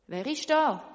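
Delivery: loud call into the dark. Acted. A voice calling from the darkness, loud call. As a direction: call out into the night. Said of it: "ruft eine Stimme".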